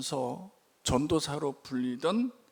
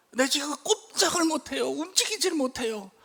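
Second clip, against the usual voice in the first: much higher, strained voicing of a stuck boss; complaining boss; 11 semitones higher than usual. Gang boss stuck in bed, strained and pleading. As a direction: whine that you can't move.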